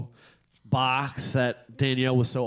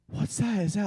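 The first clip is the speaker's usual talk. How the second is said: higher-pitched imitation of a wondering child; excited little girl; 8 semitones higher than usual. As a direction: mimic a six-year-old's eager question. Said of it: "She goes".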